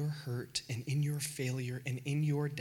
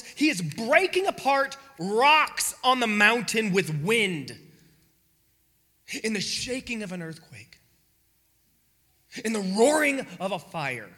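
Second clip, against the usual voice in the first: louder, declaiming the might; booming preaching; 6 semitones higher, 9 dB louder than usual. Real, not acted. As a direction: proclaim the power. Preaching God's power loudly.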